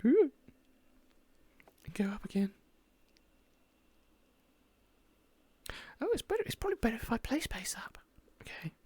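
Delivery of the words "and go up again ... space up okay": whispering. Real, not acted. Quiet asides while playing.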